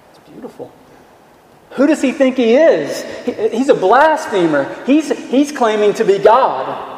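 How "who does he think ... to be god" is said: laughing mock indignation, pitched higher; amused, hammed-up scorn; mocking outrage. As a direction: play the scoffing scribe with a laugh. Voicing scribes' outraged mockery, laughing.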